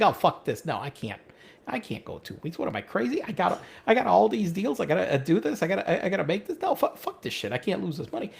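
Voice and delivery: low, soft muttered inner argument; muttering to himself. Retold or imitated. Talking himself out of a vacation, quietly muttering. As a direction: grumble it under your breath to yourself.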